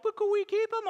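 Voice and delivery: put-on high begging child voice; pleading kids. Daughters asking Dad to keep the cat, high and begging. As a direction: beg like a little girl. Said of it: "they're all like".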